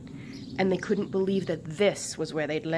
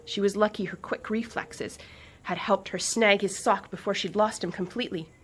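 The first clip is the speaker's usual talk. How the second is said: usual speaking voice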